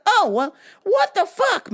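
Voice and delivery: high, slowed, indignant protest; exasperated outrage. Retold or imitated. Caller to cops doing nothing, exasperated outburst.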